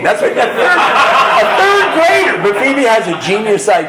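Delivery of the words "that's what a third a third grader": much higher and louder, laughing; slow, high, laughing wonder; laughing disbelief. Real, not acted. Proud dad laughing at his third grader's line, high and loud.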